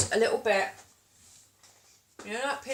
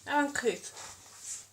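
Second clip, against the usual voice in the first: mock prim; 4 semitones higher, much slower than usual. Mock scolding of own manners.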